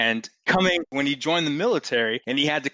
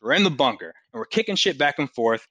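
normal talking voice

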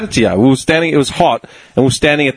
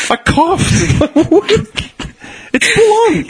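laughing quote; much slower than usual. Retold or imitated. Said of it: "I'm like".

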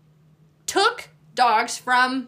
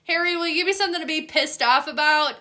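higher, louder, fast mock plea; TikTok trend voice; 6 semitones higher, 4 dB louder, faster than usual. Dogs quoted through the TikTok trend, fast high mock begging. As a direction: rattle it off quick and high like the clip. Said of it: "that TikTok trend right now".

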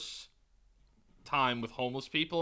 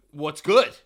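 loud, confrontational acted bark; mock challenge; 12 dB louder than usual. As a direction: throw it out as a street challenge. Acting out a homeless man's challenge.

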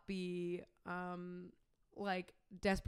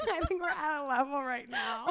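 laughing shout; 5 semitones higher than usual. Real, not acted. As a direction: blurt it out through laughter.

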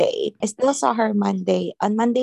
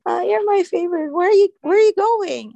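high, imitated plaintive complaint; mock protest; 9 semitones higher, 5 dB louder than usual. Patient to her favorite nurse who is leaving, mock protest. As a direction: play the needy patient, pitch lifted throughout.

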